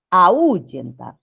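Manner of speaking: "ahuyentar" broken as a-Ú-yentar, stress on the U; slow "ahuyentar" with a separate, stressed U; mispronunciation demo. Shown as a demonstration. Teacher shows students the nonexistent word, wrongly stressed. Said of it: "sino sería".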